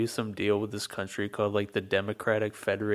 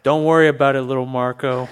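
Trump impression; 4 semitones higher, 13 dB louder than usual. Trump impression after "Donald Trump.

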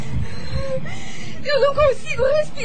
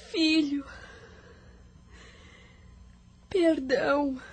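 tearful whisper; 5 semitones lower, 7 dB quieter than usual. Acted. Mother begs unborn son's pardon.

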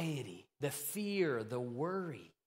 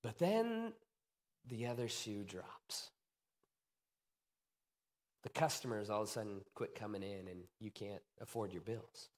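hushed and grave; 6 semitones lower, 5 dB quieter than usual. Acted. Preacher lowering voice as troubles hit. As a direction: drop low and soft for the bad news. Dramatic turn in sermon story.

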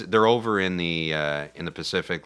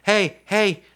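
high, louder alarmed call; urgent radio warning; 10 semitones higher, 3 dB louder than usual. Retold or imitated. Heroine radioing the crew about an attack, acted alarm. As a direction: raise the alarm over the radio, pitched way up. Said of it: "She's trying to radio them".

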